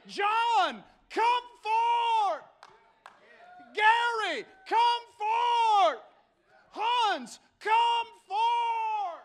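high, ringing shouted summons; calling out. Acted. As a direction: shout each name, then the command. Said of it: "God's here calling you out today".

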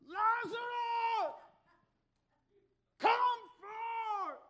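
high, long-drawn shout; loud-voiced summons. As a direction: holler it out like an old-time preacher. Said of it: "My Bible says loud voice".

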